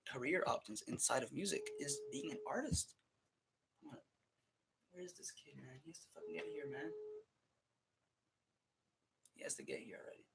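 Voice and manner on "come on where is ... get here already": annoyed mutter. Real, not acted. Waiting for late kid, muttering.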